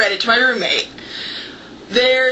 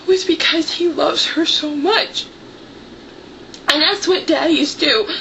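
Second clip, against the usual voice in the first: choking up; 5 semitones higher than usual. Real, not acted. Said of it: "I'm really sorry, you guys".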